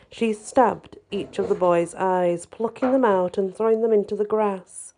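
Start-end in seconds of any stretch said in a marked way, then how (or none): none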